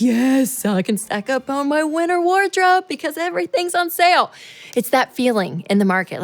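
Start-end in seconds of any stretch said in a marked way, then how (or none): 0.0-4.3 high, delighted shopper voice; giddy shopper. Retold or imitated. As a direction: squeal with shopping glee. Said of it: "And you're like".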